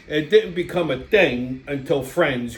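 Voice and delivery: ordinary talk, nothing marked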